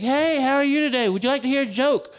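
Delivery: very high, loud chirpy imitation; mock an over-eager nurse, singsong and high; hammed-up perky greeting in a high voice; bouncy male nurse. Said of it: "he comes bouncing in".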